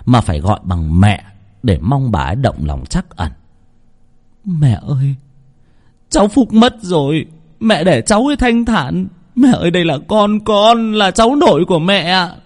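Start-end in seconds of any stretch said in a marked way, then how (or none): 4.4-12.4 pleading daughter-in-law. Acted. Daughter-in-law begging her crazed mother-in-law, higher pleading voice. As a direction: plead with the grieving grandmother.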